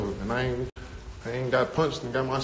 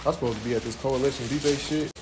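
usual speaking voice

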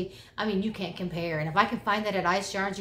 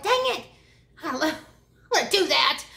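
high, loud mock frustration; playful dang-it outburst; excited self-quote; 6 semitones higher, 6 dB louder, much faster than usual. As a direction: squeal it like you just missed out.

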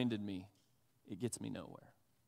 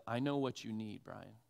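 gentle grandpa; 5 semitones lower, 9 dB quieter than usual. Retold or imitated. Grandfather quietly teaching his grandson patience, soft and low. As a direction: hush it like a loving grandfather passing on wisdom.

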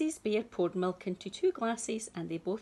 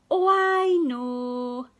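loud, slow delighted; excited realization; 6 semitones higher, 11 dB louder, slower than usual. Acted. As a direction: burst out with the idea. Mother bear realizes.

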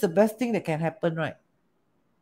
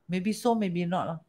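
calm wise man; 8 dB quieter than usual. Retold or imitated. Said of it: "The wise man say".

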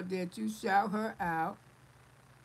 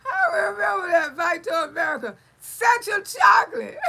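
loud, high, laughing through the words; laughing shout; 8 semitones higher, 10 dB louder than usual. Real, not acted. Cracking up at a viewer's Eddie Murphy joke, loud laughing shout.

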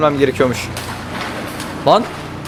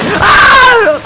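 sudden high shriek of fright; startled scream; 7 dB louder than usual. Real, not acted. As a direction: jump and shriek. Startled by game scare. (ani korku çığlığı)

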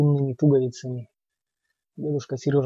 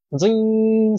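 high ringing dzyn; ringing saw twang; 8 semitones higher, 5 dB louder than usual. A narrator's reading. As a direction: ring out like a saw. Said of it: "спела пила".

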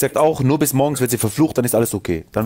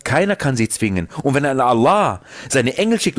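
normal talking voice

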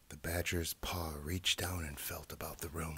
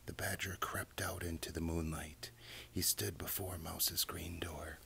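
ordinary delivery

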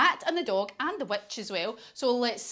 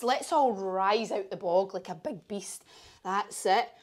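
plain reading voice

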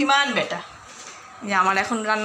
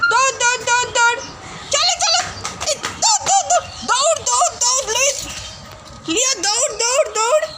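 very high, playful calls of দৌড়; excited urging; 11 semitones higher than usual. Real, not acted. Owner egging her dog Leo to run home, very high excited calls.

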